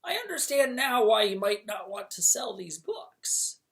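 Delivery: crackle it like a small fire spirit; small crackling voice. Acted. Calcifer answering the king in a small crackling voice. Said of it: "in his small, crackling voice".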